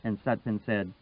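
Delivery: ordinary delivery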